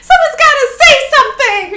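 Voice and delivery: fast, very high and loud; hammed-up desperate squeal; panicked outburst. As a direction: blurt it as a frantic yell. Sully repeats it, panicking.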